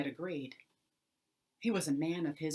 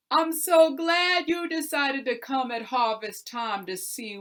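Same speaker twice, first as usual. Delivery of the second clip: higher, louder, cheerful show-off; sly tenant, delighted; 3 semitones higher, 8 dB louder than usual. Acted. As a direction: gleefully reveal the potatoes. John springs the trick.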